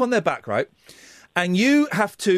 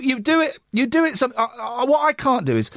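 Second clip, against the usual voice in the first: ordinary talk, nothing marked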